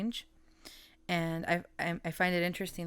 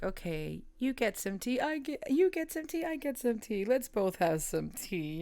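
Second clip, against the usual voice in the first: playful sharing voice; 6 semitones higher than usual. Retold or imitated. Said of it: "It's like".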